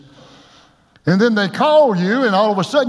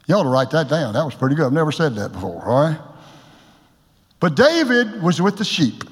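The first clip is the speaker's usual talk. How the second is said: ordinary delivery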